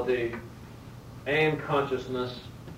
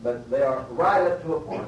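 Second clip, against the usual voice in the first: ordinary delivery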